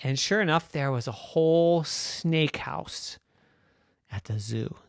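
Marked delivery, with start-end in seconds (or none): none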